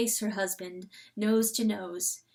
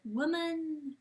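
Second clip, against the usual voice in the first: high, loud ringing echo, fading at the edges; a shout bouncing back through darkness; 8 semitones higher, 6 dB louder than usual. A narrator's reading. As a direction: let it echo back from far away. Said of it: "And an echo".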